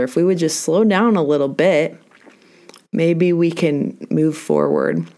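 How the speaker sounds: ordinary talk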